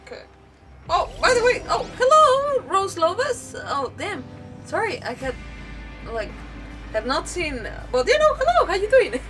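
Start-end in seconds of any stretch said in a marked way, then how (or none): none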